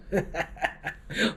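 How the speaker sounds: a quick airy laugh; breathy laugh. Real, not acted. Laughing at the restaurant name Wa Da Fa, amused.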